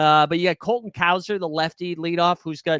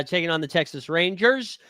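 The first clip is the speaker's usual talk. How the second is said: ordinary talk, nothing marked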